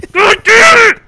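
very high, loud shout breaking into laughs; sports fan losing it, half laughing; raging shout with laughter. Real, not acted. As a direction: blow up then laugh at yourself. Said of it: "take a breath".

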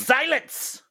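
sharp high shout of command. Acted. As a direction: cut them off with a royal shout. Queen silences an advisor.

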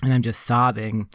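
normal talking voice

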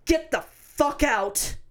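slow, deliberate retold command; slow, hard-faced dismissal; cold stern order. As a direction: stretch it out word by word, unbudging. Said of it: "with my best bitch face".

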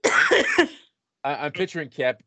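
ordinary delivery

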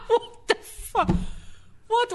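high, slow laugh through the words; laughing disbelief. Real, not acted. Reacting to the joke, laughing.

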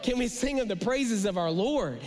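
ordinary talk, nothing marked

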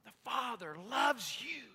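loud, slow, high call to look; excited call. Retold or imitated. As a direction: urge them to look in wonder. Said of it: "He said".

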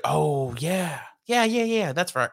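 imitating someone who just remembered; mock sudden remembering. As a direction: act out a belated realization. Acting out writers remembering something forgotten.

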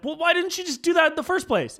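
mock-indignant, why didn't you just; imagined angry retort. To the airline agent, an exasperated high mock retort. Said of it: "And I was like".

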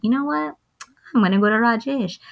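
a hint of a cartoon dog making up its mind; dog deciding.

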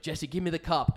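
loud, high urgent demand. Retold or imitated. Ordering his friend Jesse to hand over the cup, loud and urgent. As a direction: bark it at your mate like it's an emergency.

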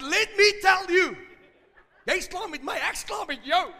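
loud, high, acted outburst; indignant shouted retort; furious telling-off. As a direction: tear into the caller at full voice. Retold angry outburst at bank caller.

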